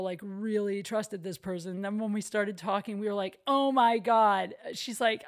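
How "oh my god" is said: loud, drawn-out mock disbelief; slow, emphatic retold astonishment; retold shock. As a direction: stretch it out as an imitated shocked reaction. Retold shocked reaction with friend.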